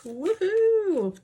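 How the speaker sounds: high, loud woohoo; excited whoop. Real, not acted. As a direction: cheer the count out loud. Five hundred counted.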